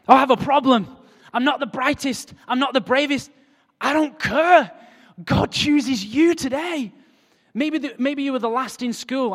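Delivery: very loud, high proclamation; climactic preaching. Acted. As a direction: shout it over the congregation.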